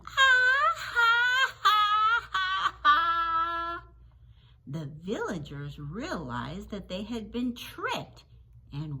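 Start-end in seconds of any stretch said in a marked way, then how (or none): 0.1-3.8 loud, high, acted storybook laugh; hammed-up boy's laughter, high and loud; mocking laugh. A narrator's reading. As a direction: laugh at them in big drawn-out ha-has. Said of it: "the boy laughed at them".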